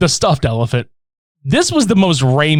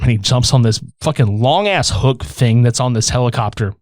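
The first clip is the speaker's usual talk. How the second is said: ordinary talk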